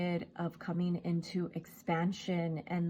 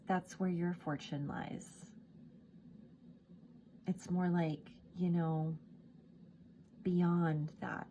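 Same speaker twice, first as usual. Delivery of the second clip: normal talking voice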